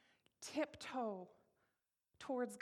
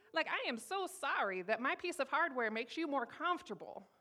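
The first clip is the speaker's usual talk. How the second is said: loud, higher-pitched outraged retort; sarcastic indignation; 5 semitones higher, 6 dB louder than usual. Retold or imitated. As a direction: fire back a sarcastic apology. Indignant sarcastic retort to those people.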